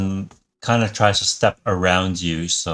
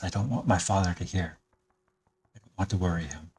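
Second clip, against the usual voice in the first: put-on softer, secretive young voice; hushed confiding; 7 dB quieter, faster than usual. Son confides to stranger away from father, hushed. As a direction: keep it from father's ears.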